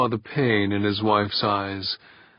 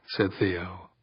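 ordinary delivery

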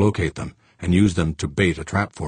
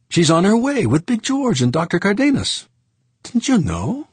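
high, louder French voice, amazed; surprised Frenchman; 4 dB louder than usual. Acted. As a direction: tell Fuchs about the conference in surprise. Saint Clair telling Fuchs.

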